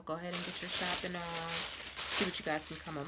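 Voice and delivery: stuffy blocked nose; talking with a stuffy nose. Real, not acted. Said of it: "I'm a little stuffy today".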